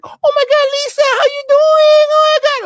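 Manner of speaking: put-on very high, loud squeal in a Nuyorican accent; shrieking Rosie Perez Nuyorican greeting. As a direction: gush like Rosie Perez greeting an old friend. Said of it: "He's like".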